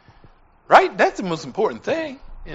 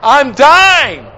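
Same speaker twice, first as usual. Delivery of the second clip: loud, high cry of a dying man; wailed, falling at the end; desperate cry; 8 semitones higher, 12 dB louder, much slower than usual. Retold or imitated. Retells dying man's desperate cries.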